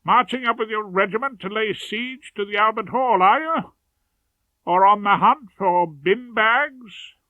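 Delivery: put-on jolly old chum teasing a schoolboy; matiest tones. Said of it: "I called out in my matiest tones".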